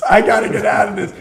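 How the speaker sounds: rising desperation, pushed harder. Retold or imitated. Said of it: "I'm like".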